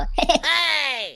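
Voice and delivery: soft chuckle; quiet, falling chuckle. Acted. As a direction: let out a soft laugh. Chuckle over the drink.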